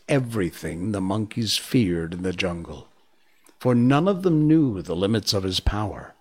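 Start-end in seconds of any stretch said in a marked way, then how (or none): none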